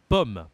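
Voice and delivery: loud pomme with a short open O. Shown as a demonstration. Teacher repeats the example word, loud open O in pomme. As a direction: punch pomme, keeping the O open. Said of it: "on a un accent différent".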